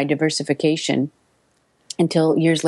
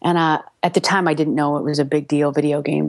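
ordinary delivery

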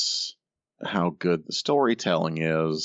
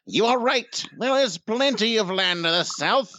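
much higher, hammy villain voice; mustache twirling villain. Retold or imitated. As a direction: ham up a cartoon villain. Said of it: "No, you're doing it".

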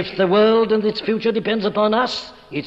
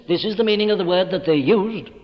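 normal talking voice

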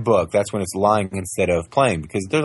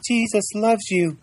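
put-on much higher, slow tiny-child voice; toddler voice; 13 semitones higher, slower than usual. Father imitating his toddler daughter, slow and very high. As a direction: say it like a two-year-old.

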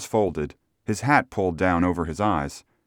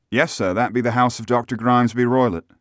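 higher-pitched voice for an obliging coachman; helpful local driver; 5 semitones higher, 3 dB louder than usual. Acted. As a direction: play the driver answering a gentleman.

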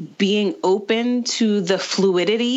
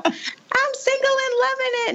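much higher, chirpy mock voice; mocking a cheerful single. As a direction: parody a carefree single girl. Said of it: "I was not one of those".

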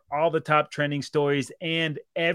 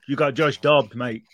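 put-on a light English accent, a bit louder; mock English accent; 4 dB louder than usual. Teasing his co-host about a player, mock English accent. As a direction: toss it off in a jokey English accent. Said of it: "I just did him in an accent".

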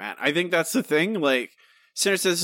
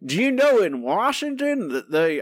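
put-on higher, swooping Southern accent; 7 semitones higher than usual. Host imitating a grandpa complaining about government, Southern accent. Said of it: "I don't know why Southern accent all of a sudden".

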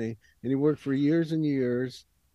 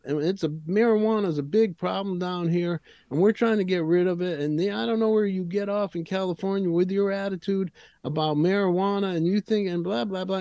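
put-on furious Southern prosecutor in Arkansas accent; 5 semitones higher than usual. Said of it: "He got the heavy Arkansas accent".

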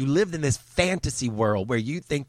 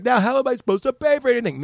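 whiny mock voice; 10 semitones higher, faster than usual. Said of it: "he's like".

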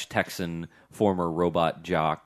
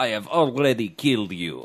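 put-on Russian villain accent; 4 semitones higher, 3 dB louder than usual. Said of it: "Alexander is basically Ivan Drago".

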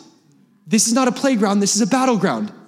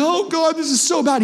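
mock despair; 10 semitones higher than usual. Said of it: "your feelings like".

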